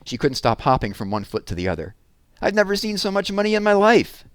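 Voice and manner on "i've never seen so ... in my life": put-on high, louder, wide-eyed girl voice; overwhelmed with joy; amazed. Jessie amazed at the pile of money, high and loud.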